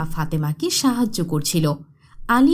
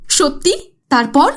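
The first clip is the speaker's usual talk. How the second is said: louder, higher excited asking; can't wait for more; eager child; 5 semitones higher, 6 dB louder than usual. Acted. (গল্প শুনতে উদগ্রীব শিশু)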